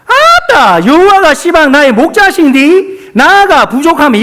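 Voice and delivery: 전라도 사투리로 높고 크게; Jeolla dialect. Acted. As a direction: belt it out in high-pitched Jeolla dialect. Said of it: "마지막 전라도 버전입니다".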